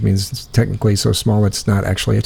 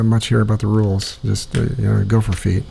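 ordinary delivery

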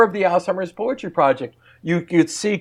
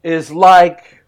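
poem recital; 8 dB louder than usual. Shown as a demonstration. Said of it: "I'm gonna say a line of poetry".

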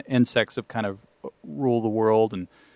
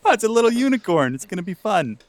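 mock naive cheer; 10 semitones higher, 4 dB louder than usual.

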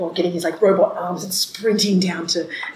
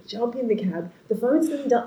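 usual speaking voice

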